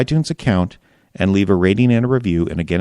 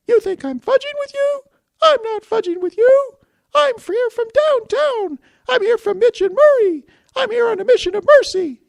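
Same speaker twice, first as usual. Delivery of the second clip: very high squeaky cartoon voice, louder; Mister Bill; 5 dB louder than usual. Podcaster voices Glengarry speech as squeaky Mister Bill. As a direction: squeak it like a tiny claymation character. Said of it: "in the style of Mister Bill".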